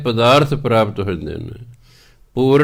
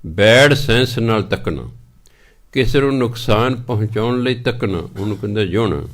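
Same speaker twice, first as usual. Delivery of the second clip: normal talking voice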